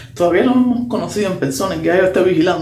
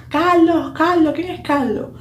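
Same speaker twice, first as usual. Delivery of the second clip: yelling out car window; 10 semitones higher, slower than usual. Retold or imitated. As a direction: shout Carlos out the window, searching. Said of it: "baja la ventanilla y grita".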